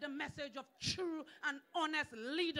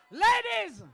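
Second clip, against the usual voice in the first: shouted much higher and far louder; calling out to the women; 6 semitones higher, 14 dB louder than usual. Real, not acted. Speaker promising the women support, full shout. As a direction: call the ladies over the crowd.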